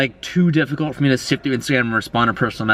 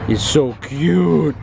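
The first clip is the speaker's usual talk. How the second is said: gushing over dog; 3 semitones higher, much slower than usual. Real, not acted. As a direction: melt over a cute puppy.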